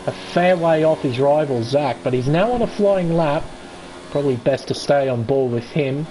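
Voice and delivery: ordinary talk, nothing marked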